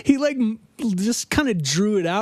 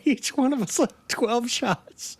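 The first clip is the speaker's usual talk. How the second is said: usual speaking voice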